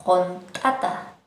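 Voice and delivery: loud slow contata, explosive ta. Shown as a demonstration. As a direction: snap the ta in contata, drawn out. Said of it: "explosivo la ta".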